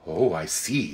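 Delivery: low, soft dawning understanding; softened and understanding; rather different, subdued voice. A narrator's reading. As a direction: drop the voice, suddenly gentle. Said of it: "in a rather different voice".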